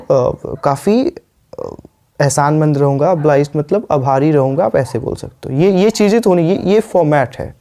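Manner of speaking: ordinary delivery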